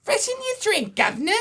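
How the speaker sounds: very high falsetto British barmaid; mock British lady, pitched high; woman's voice. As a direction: offer it in a squeaky British falsetto. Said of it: "do the woman's voice".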